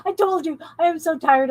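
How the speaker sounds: high, giddy, laughing through the words; bubbling over with silly excitement. Real, not acted. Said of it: "I'm so excited".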